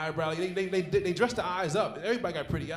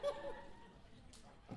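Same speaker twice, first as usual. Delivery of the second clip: whispered panic; 7 semitones higher, 14 dB quieter, slower than usual. Retold or imitated. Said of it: "I'm like".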